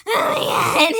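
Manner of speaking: high squeaky helium voice; helium-high exclamation. Real, not acted. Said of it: "on freaking Helium".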